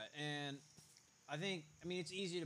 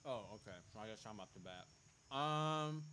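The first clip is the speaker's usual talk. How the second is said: chewing while talking. Real, not acted. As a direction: mouth full of food. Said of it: "sorry, I'm chewing".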